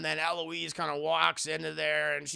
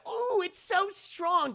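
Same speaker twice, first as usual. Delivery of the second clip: very high, slow prissy gasp; drawn-out high squeak of surprise; high slow mock shock; Eloise's voice; 14 semitones higher, slower than usual. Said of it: "And Eloise is like".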